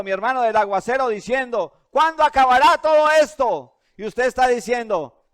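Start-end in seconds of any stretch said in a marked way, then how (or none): 1.9-3.8 high and loud, despairing wail; anguished cry. Acted. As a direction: cry out like someone lost in the storm. Others panicking in the storm.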